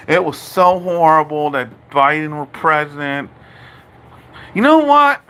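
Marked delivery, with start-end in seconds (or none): 4.5-5.2 high, loud challenge; a sharp high call for attention; sudden outburst. Real, not acted.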